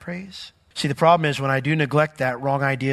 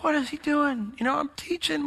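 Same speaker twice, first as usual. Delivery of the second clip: high, softer puzzled aside; mock-bewildered, half under the voice; bewildered reaction; 10 semitones higher, 5 dB quieter than usual. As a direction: replay your bafflement, pitched way up. Retold bewilderment at parrot mid-sermon.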